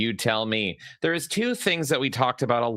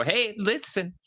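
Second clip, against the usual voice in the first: a higher light Navi imitation; mild Navi impression; imitating Navi; 8 semitones higher than usual. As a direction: chirp it like a fairy sidekick.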